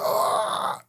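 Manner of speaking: breathy mock cry of pain; agonized cry. Recalling the shot man crying in agony, mock cry. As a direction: voice the victim's scream. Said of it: "the guy cried in agony".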